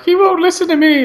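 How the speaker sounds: very high mock wail; pleading Jody. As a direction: whine it as helpless Jody. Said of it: "and Jody's like".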